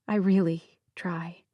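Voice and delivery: a breathy near-whisper, slowed down; hushed, tired confession. A narrator's reading. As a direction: let it slip out under your breath, a little defeated. Narrator's weary admission after heartbreak.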